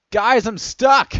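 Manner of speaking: high, loud, drawn-out yell; slow, full-voiced call for rescue; panicked yell. A narrator's reading. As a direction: yell for help. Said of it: "he yelled into our ears".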